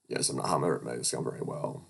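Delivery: a lightly voiced skittish coworker; timid fox. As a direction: mumble it like a nervous fox. Nervous fox Dave answers.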